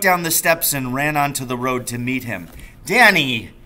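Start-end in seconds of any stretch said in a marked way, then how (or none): 2.8-3.5 a hint of the father, louder; father crying out. A narrator's reading. Father, surprised to find his son on the road, calls out louder. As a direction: call out the boy's name. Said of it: "he cried".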